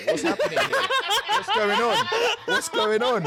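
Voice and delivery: high, incredulous laughing questions; baffled disbelief. Retold or imitated. Fighter retelling the encounter with his rival, incredulous and high. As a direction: squeak out the confusion like it's absurd.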